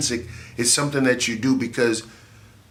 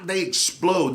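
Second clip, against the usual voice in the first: normal talking voice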